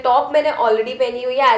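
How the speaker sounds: Hindi-English code-switching; switching languages midsentence. Real, not acted. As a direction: swap between English and Hindi mid-line.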